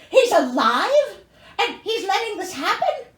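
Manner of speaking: can't believe the father is alive; outraged disbelief. Real, not acted. Reader reacting to the story, loud and incredulous.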